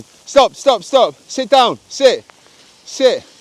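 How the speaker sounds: loud, high sharp commands; firm dog commands. Real, not acted. Owner stops his dog as a car passes, loud firm commands. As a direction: make the dog freeze.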